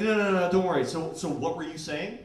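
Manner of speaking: loud, laughing no-no-no; laughing reassurance. Retold or imitated. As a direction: brush it off through laughter. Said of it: "she's like".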